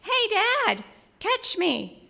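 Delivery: high, loud happy shout of Hey, Dad; boy's joyful yell. Retold or imitated. Son yells to his dad from the cliff, a high joyful shout. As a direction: call out joyfully from above. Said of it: "I heard a voice from above me yell".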